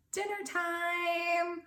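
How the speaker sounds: slow, sing-song dinner call; slow announcement. Acted. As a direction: stretch it like a dreaded chore. Mama's plans listed.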